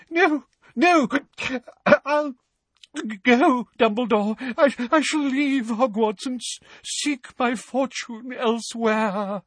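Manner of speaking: a distraught seer fighting back tears; Trelawney's tearful voice. Acted. Trelawney refusing Dumbledore's kindness, high and tearful.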